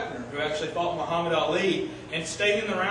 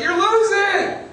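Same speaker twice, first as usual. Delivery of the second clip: much higher and louder, slow yell; trainer yelling; 12 semitones higher, 9 dB louder, much slower than usual. Retold or imitated. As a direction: bellow the warning at the fighter. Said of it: "he was yelling at him".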